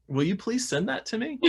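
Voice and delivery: lightly hammed-up eager please. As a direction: ask sweetly, a little begging. Retold eager request to doctor.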